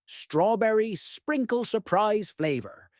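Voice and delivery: plain reading voice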